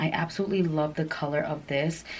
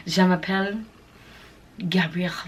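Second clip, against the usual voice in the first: put-on her own name said in a playful French accent, Gabiella; mock French accent. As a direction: introduce yourself as a Parisian in a French accent. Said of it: "That's how I feel right now".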